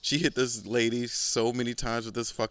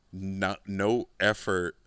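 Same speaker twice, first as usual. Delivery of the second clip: normal talking voice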